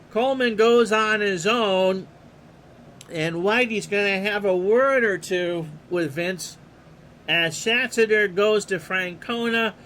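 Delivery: steady announcer call of the action; play-by-play. Acted. Announcer calling the pitch to the listeners, play-by-play. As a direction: call the game like a radio announcer.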